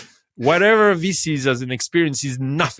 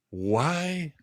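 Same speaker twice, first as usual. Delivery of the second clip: higher, rising astonishment; excited retold wonder; 7 semitones higher, slower than usual. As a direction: gush like a convert. Both amazed after the ceremony.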